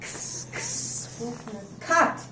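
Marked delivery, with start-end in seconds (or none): 0.0-1.1 x breathed as a soft unvoiced ks; breathy x. Shown as a demonstration. Teacher showing the x sound. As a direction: hiss the x softly. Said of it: "X says x".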